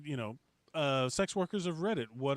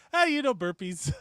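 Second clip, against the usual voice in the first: mock casual; 6 semitones higher, 5 dB louder than usual. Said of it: "he just goes".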